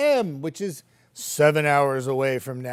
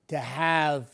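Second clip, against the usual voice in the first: normal talking voice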